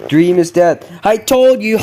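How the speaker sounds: loud, high triumphant taunt; gloating yell. Real, not acted. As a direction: crow it over him.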